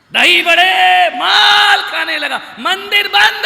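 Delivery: excited shout. Acted. Preacher mocking the seth's feasting, loud excited shout. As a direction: proclaim it to the whole crowd.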